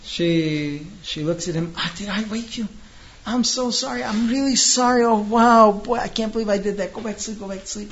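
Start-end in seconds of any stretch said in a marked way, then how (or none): 1.8-6.9 high, fluttery over-the-top sorry; wife gasps and gushes, making a show of regret; exaggerated wife falling over herself apologizing; gushing apology. Wife apologizes after waking him. 6.9-7.9 soothing hush. Retold or imitated. Wife soothes him, sneaks out.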